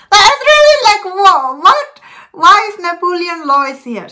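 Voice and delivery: high, loud burst of bafflement, easing down; exaggerated high-pitched astonishment; mock disbelief. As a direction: act out a stunned viewer's "what?". Mimics shocked reaction to the film.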